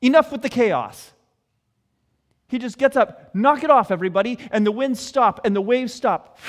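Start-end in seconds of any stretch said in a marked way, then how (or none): 0.0-1.1 loud, stern fatherly scolding; dad scolding his kids. Acted. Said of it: "As though it's a dad talking to his kids". 3.3-4.4 loud, stern fatherly scolding; dad scolding his kids. Acted. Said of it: "As though it's a dad talking to his kids".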